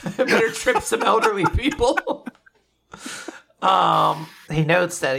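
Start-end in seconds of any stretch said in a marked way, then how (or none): 0.0-2.3 mock villain. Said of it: "Yeah, bump, bump".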